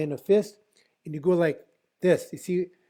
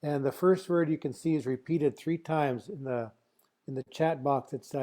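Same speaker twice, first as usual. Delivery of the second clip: usual speaking voice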